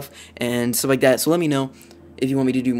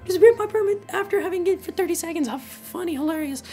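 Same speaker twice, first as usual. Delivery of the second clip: put-on amused voice, much higher; forced laughing. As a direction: fake cheerful laugh-talk pitched much higher. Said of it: "on the outside, I was like".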